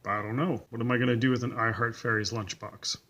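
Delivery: usual speaking voice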